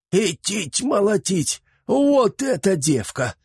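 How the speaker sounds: put-on high, slow awed old man; excited exclamation. A narrator's reading. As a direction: exclaim in delight at the girl. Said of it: "воскликнул".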